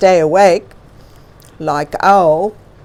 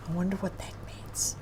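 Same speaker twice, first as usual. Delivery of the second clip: put-on fast, much softer whisper to the kids; whispered aside; 14 dB quieter, faster than usual. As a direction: lean in and whisper the wonder.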